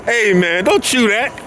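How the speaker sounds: ordinary talk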